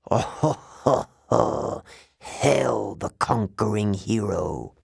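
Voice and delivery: mocking villain's laugh into a grand hail; evil voice laughing. Evil voice hailing the hero in a dream, mocking laughter. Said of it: "The evil voice began to laugh".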